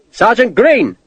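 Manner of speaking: loud, high repeated call; officer calling again. A narrator's reading. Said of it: "Sharp bellowed again".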